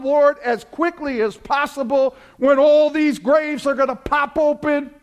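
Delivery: high, loud, ringing sermon climb; soaring revival preacher voice; full-force preaching. Acted. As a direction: preach it to the rafters with rising fire.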